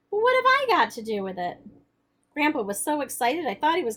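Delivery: expressive read-aloud voice, the characters lightly acted; story narration. A narrator's reading. Teacher reading the novel to the class, expressive narration. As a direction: read the chapter aloud with feeling.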